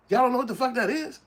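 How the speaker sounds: high, loud disbelief; incredulous mock question. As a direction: ask it like you can't believe they don't know. Newcomers mocking in acted quote.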